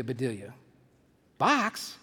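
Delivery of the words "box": higher, questioning yelp of surprise; acted one-word disbelief; startled exclamation. Employer hears chicken is boxed, startled exclamation. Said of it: "exclaimed Missus Rogers".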